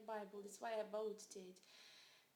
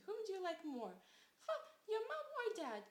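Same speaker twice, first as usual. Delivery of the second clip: high, loud, prying parent's voice; mock parents asking; 8 semitones higher, 6 dB louder than usual. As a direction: ham up the parents' nagging question.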